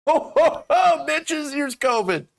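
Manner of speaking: hammed-up Santa dropping covid gifts; mock Santa. Streamer voicing Santa spreading covid, jolly and loud.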